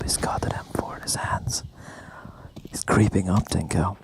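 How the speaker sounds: breathy, whispered play-by-play; seductive whisper. Pretend. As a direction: whisper it close and teasing. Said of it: "give me some more seduction".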